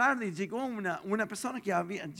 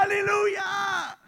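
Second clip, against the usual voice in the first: high, slow, loud aleluya; shouted hallelujah; 7 dB louder, much slower than usual. Retold or imitated. Pastor telling Pato to shout, long loud aleluya. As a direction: draw out a big shouted aleluya. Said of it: "Levanta tu mano y grita".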